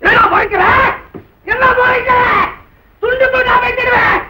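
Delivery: high-pitched threatening shout; furious threat. Acted. Man threatening the relative glaring at him, furious threat. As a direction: build to a yelled threat. Threatening a rival who glares.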